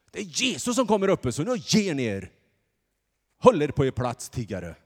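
loud, sharp telling-off; scolding crowd. Acted. Crowd scolding the beggars, loud and sharp. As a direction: put the beggars in their place.

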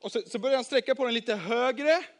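plain reading voice

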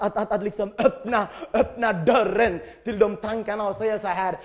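ordinary talk

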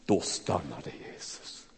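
low, slow, soft announcement; hushed. Shown as a demonstration. Preacher dwelling on the moment Jesus stops, low and soft. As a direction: let the words fall quietly and slowly. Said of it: "målar en sån underbar gudsbild".

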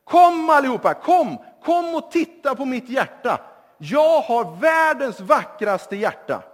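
put-on a young man showing off, calling everyone over; calling the crowd loudly. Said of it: "så ropar han så här".

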